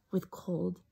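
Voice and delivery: hushed unvoiced whisper. A narrator's reading. Narrating a trembling bat in a whisper. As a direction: whisper the fear.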